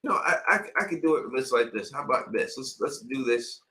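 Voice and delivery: usual speaking voice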